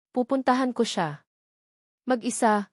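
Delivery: plain reading voice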